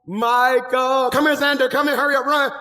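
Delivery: loud, urgent summons; calling out. Acted. Preacher calling Michael and Xander to come running, shouted. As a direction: holler names across the room and hurry them over. Calls helpers over from afar.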